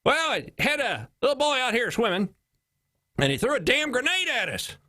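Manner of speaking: high, hammed-up Texas caller; Texas accent. Texas caller reporting a boy with a grenade, mock Texas accent. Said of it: "This is Texas accent, by the way".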